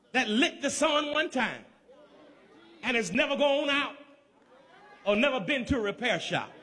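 ordinary delivery

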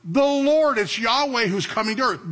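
building to a high preaching peak; fervent climax. Acted. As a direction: drive home that God is coming. Climax of sermon point.

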